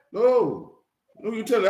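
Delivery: ordinary delivery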